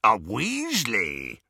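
slow, drawn-out rasp. A narrator's reading. Werewolf hearing Ron's surname, slow rasp. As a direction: rasp the name out slowly. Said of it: "rasped Greyback".

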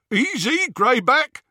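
high, loud jeer; jeering call. A narrator's reading. Gang member to Greyback over jeering, high loud call. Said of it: "over the jeering of the others".

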